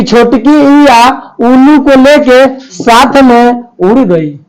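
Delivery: slow, deliberate chant of the memory trick; slow mnemonic recital. Shown as a demonstration. Teacher reciting the vowel mnemonic slowly to students. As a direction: spell it out slowly so students remember. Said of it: "छोटकी बोलेंगे कि".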